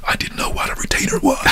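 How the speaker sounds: confiding stage whisper; whispered aside. Real, not acted. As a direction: whisper it like a secret admission.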